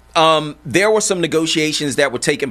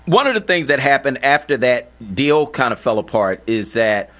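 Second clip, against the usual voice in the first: ordinary delivery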